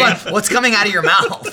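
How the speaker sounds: laughing retold incredulity; mock bafflement. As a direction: act out amused disbelief at someone's speech. Acts out viewers' baffled reaction.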